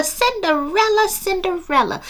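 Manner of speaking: a ridiculous, obnoxious Southern fairy godmother calling out; loud Southern fairy godmother. Retold or imitated. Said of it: "with this country Southern accent talking to Cinderella".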